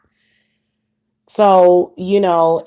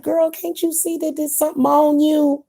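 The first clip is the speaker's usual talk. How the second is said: higher, half-teasing disbelief; exasperated aside; 8 semitones higher than usual. Retold or imitated. Vlogger imitating what she'd tell a witch, in higher mock disbelief. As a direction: talk to her like she should already know. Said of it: "I'm like".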